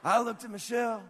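high, loud preacher impression; Pastor Rick. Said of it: "This is my best Rick impression".

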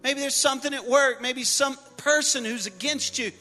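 high, shouting preacher, building; preaching climax. Acted. Pastor urging the church to speak, loud and rising. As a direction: call it out boldly to the crowd.

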